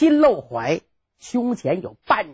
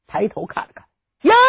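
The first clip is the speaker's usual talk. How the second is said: usual speaking voice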